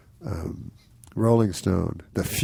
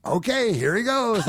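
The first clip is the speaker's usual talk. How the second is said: higher, breezy hand-off; mock emcee; 13 semitones higher than usual. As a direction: toss it off like an emcee, pitched up. Organizer hands over the stage.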